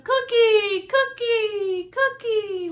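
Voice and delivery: put-on high, loud kids' chant; excited children calling; children shouting. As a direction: shout it like a hungry playground crowd. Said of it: "Shouts of".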